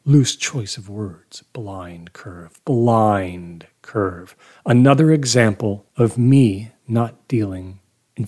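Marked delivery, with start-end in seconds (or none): 1.5-2.5 hushed whisper, breathy and low. A narrator's reading. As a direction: breathe it out, barely voiced. Narrator repeats phrase, first hushed.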